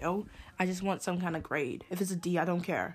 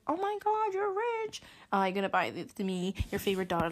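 sarcastic impression of family asking for money; mocking relatives. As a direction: mimic a greedy relative fawning.

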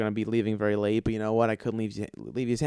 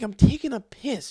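defensive; 10 semitones higher, 7 dB louder than usual. Retold or imitated. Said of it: "I'm like".

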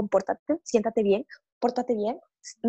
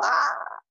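breathy excited ah; playful baby sound; imitating a gleeful baby squeal. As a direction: voice a happy baby's squeal. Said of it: "siempre están como".

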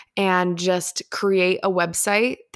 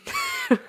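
short embarrassed laugh. Real, not acted. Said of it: "I totally just spat on my mic".

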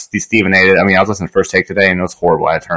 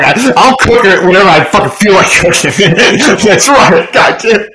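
mock defiant; 10 semitones higher, 3 dB louder than usual. Mocking friend's excuse, laughing.

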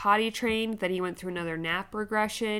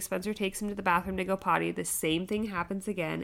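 ordinary talk, nothing marked

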